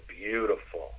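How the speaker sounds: soft, lingering seductive word; resonant. Shown as a demonstration. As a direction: let the word resonate, softly. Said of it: "really resonate with those words".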